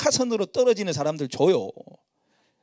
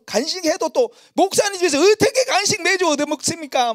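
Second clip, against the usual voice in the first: higher, louder mock protest of a flock member; 11 semitones higher, 6 dB louder than usual. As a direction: play the member who refuses, loudly. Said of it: "막 이러는 거예요". (밥 얻어먹기 미안해 거절하는 목장 식구, 높고 큰 목소리로 항의하듯)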